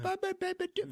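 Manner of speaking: put-on squeaky baby-talk gibberish; very high nonsense babble; Jar Jar Binks. Fan mocking the character's baby talk, squeaky babble. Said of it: "his baby talk is just".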